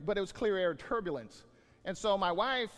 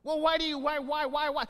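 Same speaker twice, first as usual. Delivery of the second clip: mock questioner; 7 semitones higher, 5 dB louder than usual. Mock dialogue question and answer.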